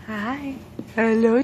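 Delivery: normal talking voice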